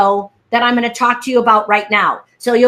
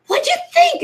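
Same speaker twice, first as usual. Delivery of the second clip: very high, eager squeal of a question; giddy high-pitched impression of eagerness; imitated excitement. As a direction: mimic an excited caller fishing for praise. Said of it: "she was all excited".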